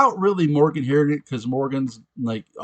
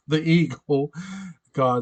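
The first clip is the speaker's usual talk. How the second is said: usual speaking voice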